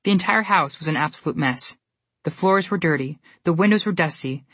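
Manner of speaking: plain reading voice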